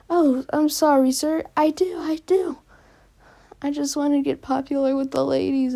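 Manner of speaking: a lightly voiced desperate student begging; whiny pleading kid. Mineta begs Aizawa.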